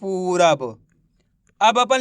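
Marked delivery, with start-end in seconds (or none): 0.0-0.6 पूरब as one finished word, lower; blended word. Shown as a demonstration. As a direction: settle पूरब down as the joined-up answer. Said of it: "तो मिलके बन गया". (पूरब को मिलाकर, नीचे सुर में)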